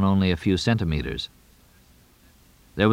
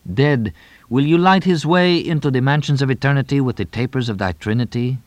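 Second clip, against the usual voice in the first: solemn prayer; 5 semitones higher than usual. A narrator's reading. Tonino praying to the sky over his dead friend, solemn. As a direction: intone it like a graveside blessing. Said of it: "A prayer".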